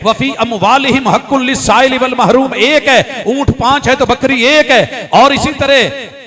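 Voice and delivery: full-force preaching. Real, not acted. Preacher tells congregation the worship is one, forceful preaching. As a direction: hammer out each item for the congregation. Preaches unity in rhythmic list.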